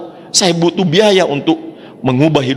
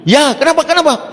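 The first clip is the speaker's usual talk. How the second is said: anxious answer; 12 semitones higher than usual. Retold or imitated. His anxious answer on phone.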